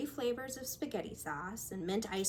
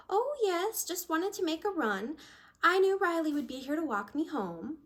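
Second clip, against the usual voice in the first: put-on higher, chirpy old lady voice; a sweet elderly customer, pitched up; lightly voiced old woman; 7 semitones higher, 3 dB louder than usual. Old woman telling Amanda Riley will walk her home, high and light.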